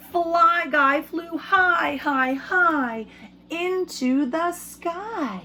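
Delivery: a slow, lifted read-aloud rise for the sky; soaring high. A narrator's reading. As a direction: let the voice fly up with the fly. Fly soars high in story.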